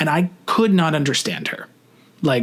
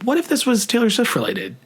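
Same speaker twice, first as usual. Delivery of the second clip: higher, urging inner voice; the pushy part of me egging me on; 7 semitones higher than usual. Retold or imitated. To himself, a lightly voiced inner urge. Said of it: "a part of me that was like".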